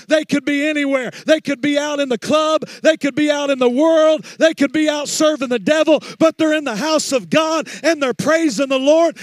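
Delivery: high, loud, building sermon cry; preaching at full force. Acted. Pastor preaches to his church about the teens, building to a loud cry. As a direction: drive it home to the congregation.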